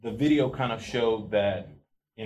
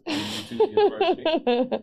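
mock grand; 8 dB louder than usual. Real, not acted. Joking self-mocking boast.

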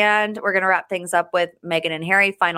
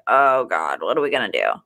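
dropped much lower, hammed-up dread; mock panic; 10 semitones lower than usual. Host retelling lost plane seats, mock despairing, low. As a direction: groan it out low like doom is coming. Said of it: "And we're like".

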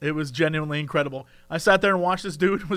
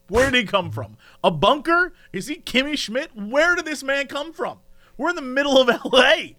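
higher, insistent baffled questions; incredulous rant; 6 semitones higher than usual. Real, not acted. Storyteller baffled by a man new to coffee, incredulous rant. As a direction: fire off questions in comic disbelief. Ranting in disbelief about customer.

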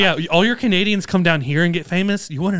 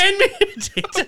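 hammed-up Irish brogue, much higher, laughing; high laughing echo of the Irish joke; mock Irish accent; 14 semitones higher, 3 dB quieter than usual. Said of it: "mock Irish bit".

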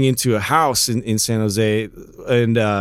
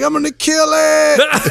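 high, slow threat to kill; 3 dB louder, slower than usual. Retold or imitated. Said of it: "I'm like".

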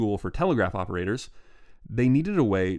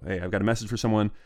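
usual speaking voice

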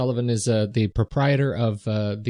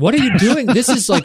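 much higher and louder, disgusted shock; grossed-out outburst; 11 semitones higher, 6 dB louder than usual. Real, not acted. Hearing co-host's stubble over the mic, grossed-out cry. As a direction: recoil at the noise, half-laughing.

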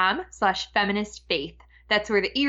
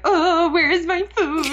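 put-on high, louder whiny baby voice; mock hungry toddler; 9 semitones higher, 4 dB louder than usual. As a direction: play the panicked fifteen-month-old. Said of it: "Benjamin in the morning, like".

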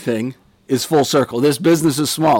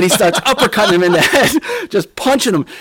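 laughing; 8 semitones higher, faster than usual. Real, not acted. Laughing while telling the fight.

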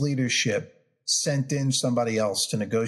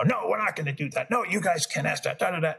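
higher-pitched mock berating; mock yelling lawyer; 5 semitones higher than usual. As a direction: imitate a lawyer yelling no at everything. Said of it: "Everything he was yelling about".

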